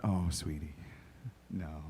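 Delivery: low, very soft, sympathetic; gentle letdown. Retold or imitated. Husband gently answering his wife, soft and low. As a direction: let her down softly.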